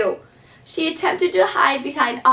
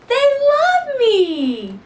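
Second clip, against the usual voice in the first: high, slow, louder delight; joyful discovery; 13 semitones higher, 5 dB louder, much slower than usual. Acted. Maisie realises they love her, high, slow and joyful. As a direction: savour the happy ending. Daisy's final petal.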